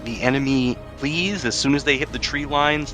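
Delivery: normal talking voice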